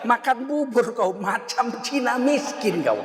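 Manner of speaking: slow, laughing mock jeer; mocking tease. Teasing a porridge eater, slow laughing jeer. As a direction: drawl the teasing insult with a grin.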